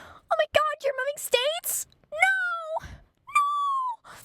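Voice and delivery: very high squealed fake heartbreak; hammed-up wailing protest; mock dismay. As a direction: pretend devastation, squeal way up high. Sarcastic fake dismay at his leaving.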